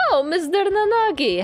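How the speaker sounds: high, bright call. Acted. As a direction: call his name cheerfully. Heroine calls out to teacher.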